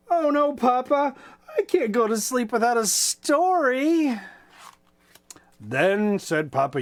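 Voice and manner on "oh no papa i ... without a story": lightly voiced child hen, higher, begging; a small child whining for one more story; pleading little chicken.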